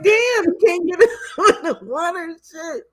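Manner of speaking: high-pitched, laughing through it; laughing disbelief. Retold or imitated. Fan reacting to the water mix-up, high laughing disbelief. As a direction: react to the corner chaos with a laugh, voice up. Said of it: "I'm like".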